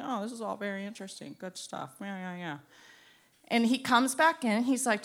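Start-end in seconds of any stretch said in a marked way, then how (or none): 0.0-2.6 fast, low, soft murmur; feigned interest. Acted. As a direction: mumble approval as if being watched. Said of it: "when we think we're being watched".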